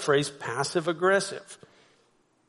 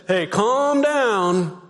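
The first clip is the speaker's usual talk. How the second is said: raised, drawn-out mock command; acted heated "calm down"; telling someone off; 4 semitones higher, 5 dB louder, much slower than usual. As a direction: push it out slowly and louder. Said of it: "someone says".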